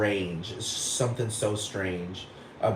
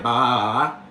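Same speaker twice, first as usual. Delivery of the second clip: a hint of ditzy socialite babble. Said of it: "that's just what she be talking like".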